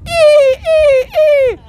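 very high, held "ee" squeals; repeated high-pitched dolphin "ee". Shown as a demonstration. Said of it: "the I is an orgasmic dolphin".

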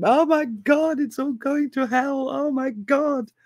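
high, frantic mock panic, slowing on the last "oh my God". President panicking over plummeting stocks, mock screaming. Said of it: "nobody is served by you starting to scream".

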